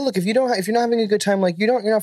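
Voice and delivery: high, quick overly polite tone; customer service voice. Retold or imitated. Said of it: "Best customer service voice".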